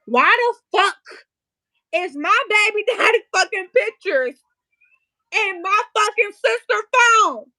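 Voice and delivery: loud, high-pitched indignant outburst; outraged. Real, not acted. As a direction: demand an answer, loud and furious. Outraged at sister having the pictures.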